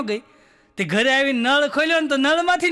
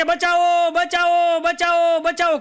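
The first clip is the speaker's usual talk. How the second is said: raised, higher repeated calls of help; hammed-up panic, calling for rescue; mock cries for help; 5 semitones higher, 3 dB louder, much slower than usual. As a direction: passengers shouting for help as the bus sinks, in a hammed-up panic. Passengers cry for help.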